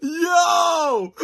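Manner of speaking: a burst of real disbelief; shocked shout. Real, not acted. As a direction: blurt it out like a stunned fan. Reacting to the reunion bombshell.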